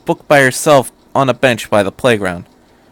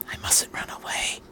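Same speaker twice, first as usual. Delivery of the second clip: unvoiced whisper to himself; whispered thought; 17 dB quieter than usual. A narrator's reading. Shinji bracing himself, whispered. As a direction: breathe it as an inner thought. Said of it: "he thought".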